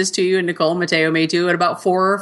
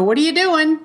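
put-on mom calling to child; 9 semitones higher than usual. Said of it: "And I go".

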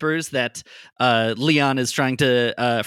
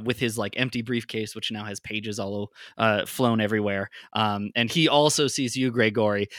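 ordinary talk

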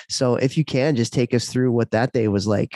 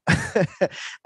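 short laugh; 11 semitones higher than usual. Real, not acted. Host laughs mid-sentence.

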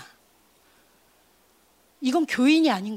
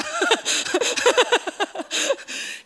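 long high burst of laughter; laughing; 8 semitones higher than usual. Real, not acted. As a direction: break into rapid, high-pitched laughs. Laughs after own remark.

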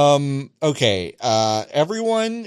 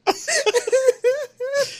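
high squealing laugh. Real, not acted. Cracking up at the story.

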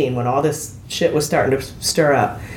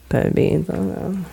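catchphrase tease; much slower than usual. Retold or imitated.

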